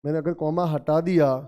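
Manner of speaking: normal talking voice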